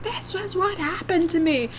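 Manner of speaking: high-pitched exasperated plea; pleading, pitched well up; exasperated outburst. Acted. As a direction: burst out as if nobody gets it. Mock outburst at everybody.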